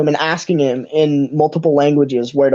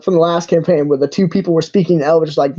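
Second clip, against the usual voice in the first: ordinary delivery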